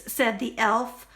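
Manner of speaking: plain reading voice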